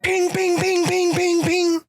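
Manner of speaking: very high ringing pings; shovel pings. Retold or imitated. Mimicking the shovel hitting his chest, very high pings. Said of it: "And he just goes".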